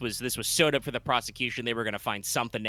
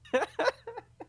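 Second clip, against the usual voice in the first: laugh. Real, not acted. Laughing at own bit.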